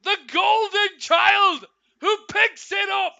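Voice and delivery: shouting live play-by-play; excited goal call. Real, not acted. Goal commentary at full force.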